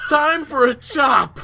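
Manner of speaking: loud, high, drawn-out character shout; slow, high-pitched holler; Jimmy impression. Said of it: "That's Jimmy from South".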